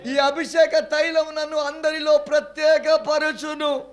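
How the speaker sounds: rising, high, loud declarations; preaching at full force. Acted. Preacher leading the church in anointing claims, building high and loud. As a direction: climb with each line to a slow, ringing finish.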